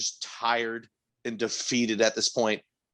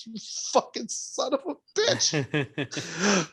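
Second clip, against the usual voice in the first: laughing insult; 6 semitones higher, much slower than usual. Retold or imitated.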